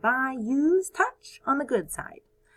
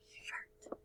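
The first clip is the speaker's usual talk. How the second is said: whisper; 22 dB quieter than usual. Acted. Whispered answer.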